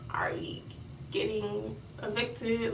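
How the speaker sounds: a hushed, worried opening line; hushed question. Retold or imitated.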